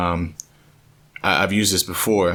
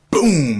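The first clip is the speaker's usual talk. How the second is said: loud, high boom falling away; sudden explosive boom; booming impact; 7 semitones higher, 5 dB louder than usual. Retold or imitated. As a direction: punch out the sound of a hit. Wave hits him, retold.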